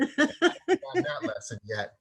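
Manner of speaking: cracking up while admitting it; laughing. Real, not acted. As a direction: laugh through it at your own expense. Repeats the joke, laughing.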